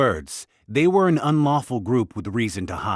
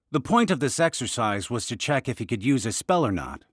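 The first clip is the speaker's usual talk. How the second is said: ordinary delivery, nothing marked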